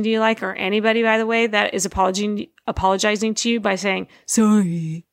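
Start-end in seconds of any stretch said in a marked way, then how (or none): 4.3-5.1 low, mock-sulky sorry; grudging sorry, imitating someone who doesn't mean it; insincere apology. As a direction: drag out a fake apology with attitude. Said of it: "and it's insincere".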